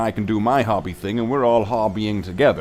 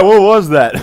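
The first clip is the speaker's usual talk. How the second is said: much higher and louder startled yelp; 9 semitones higher, 13 dB louder than usual. Retold or imitated. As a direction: replay the shock of a sudden noise. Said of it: "it was just like".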